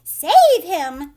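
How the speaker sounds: very loud desperate scream. A narrator's reading. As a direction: scream for help. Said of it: "screamed Missus Gloop".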